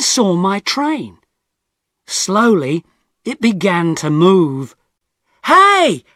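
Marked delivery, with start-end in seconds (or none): 5.4-6.0 sudden high, loud yell; shouted. Acted. Tom yelling as his train leaves, loud and high. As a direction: call out as the train pulls away. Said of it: "I shouted".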